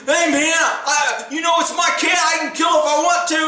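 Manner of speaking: high mock-defiant impression; protesting husband. Arrested husband protests, retold.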